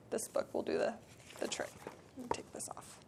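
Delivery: breathy private aside, barely voiced; whispered aside. Real, not acted. Speaker whispering an aside to herself before reading, whispered. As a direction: whisper it off-mic to yourself. Aside while preparing to read.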